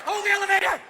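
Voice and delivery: high loud call. Pretend. Man in the hallway calling to the elevator, loud call. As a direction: yell for the door to stay open.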